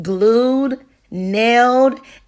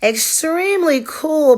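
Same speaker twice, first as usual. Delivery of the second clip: put-on higher, drawn-out boy's voice; slow hesitant reply; 7 semitones higher, slower than usual. A narrator's reading. Raphael answers Maya slowly and hesitantly, higher voice. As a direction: stall politely before the bad news. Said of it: "Raphael responded slowly".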